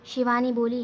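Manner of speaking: usual speaking voice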